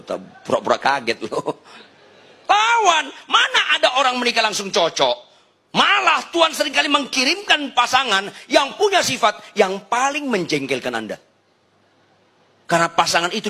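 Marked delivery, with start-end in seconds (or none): 2.5-11.2 preaching shout. Real, not acted. Preacher challenging couples about marriage, high emphatic shout. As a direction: cry it out high to the crowd.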